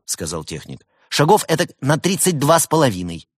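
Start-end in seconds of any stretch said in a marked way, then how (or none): 1.1-3.3 higher, louder, chirpy helpdesk voice; bright-eyed lad answering the helpline; cheerful young technician. A narrator's reading. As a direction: play an upbeat young support worker. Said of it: "молодой и жизнерадостный".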